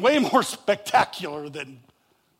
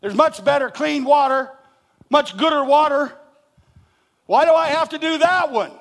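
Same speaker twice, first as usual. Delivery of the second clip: mock whining; 6 semitones higher, 6 dB louder than usual. Mocking Naaman's complaint.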